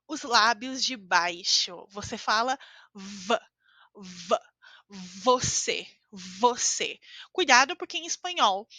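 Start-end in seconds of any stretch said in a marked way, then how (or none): 1.9-7.0 V buzzed with upper teeth on lower lip; slow, careful "você" with a clear V. Shown as a demonstration. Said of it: "você precisa colocar os seus dentes de cima".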